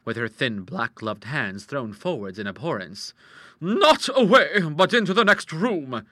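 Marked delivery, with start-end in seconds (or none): 3.6-6.1 loud, high, deliberate order; imperious hostess taking charge; commanding. A narrator's reading. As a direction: speak as one whose word is law. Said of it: "with the air of one whose word was law".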